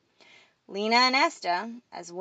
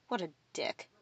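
snort, then a softer muttered insult; disgusted; 3 semitones lower, 9 dB quieter than usual. A narrator's reading. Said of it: "Emily snorted in disgust".

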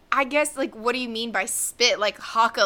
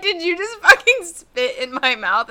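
higher-pitched, acted-out disbelief; mock incredulous; 7 semitones higher, 4 dB louder than usual. Acting out confronting a partner who spat, mock incredulous. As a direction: challenge them as if you can't believe it.

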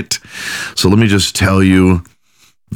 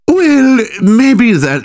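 high, loud mock German accent; Freud accent; 4 dB louder, much slower than usual. Fake therapist answering the client as Freud, mock German accent. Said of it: "put on my Freud accent".

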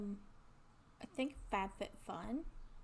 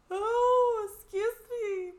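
talking through a big yawn; words stretched by a yawn; 11 semitones higher, 13 dB louder, much slower than usual. Real, not acted. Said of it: "Big yawn".

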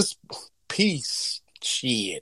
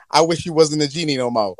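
usual speaking voice

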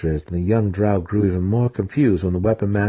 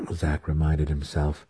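usual speaking voice

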